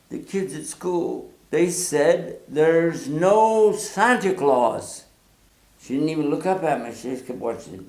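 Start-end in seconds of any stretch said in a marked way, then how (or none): 0.1-5.0 put-on slow, dragged-out child's complaint; slow, wounded report. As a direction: tell it slowly, hurt. Child reports to mother slowly.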